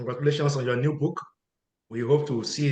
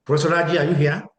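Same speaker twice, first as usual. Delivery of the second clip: raised voice, calling a name into the room; 3 semitones higher, 7 dB louder, much faster than usual. Real, not acted. Host calling for the absent panelist, louder. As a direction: call out to check if he's there.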